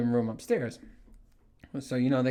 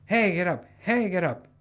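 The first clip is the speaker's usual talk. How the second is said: wife waking him; 6 semitones higher than usual. Retold or imitated. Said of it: "my woman's just slapping me".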